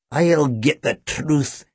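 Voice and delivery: slow, menacing growl. A narrator's reading. Boyle threatening the boys, growling. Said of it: "he growled".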